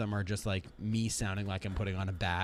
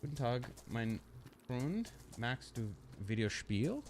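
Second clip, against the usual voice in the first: German greeting; 2 semitones higher, 5 dB quieter, slower than usual. Acted. Game player greets in German.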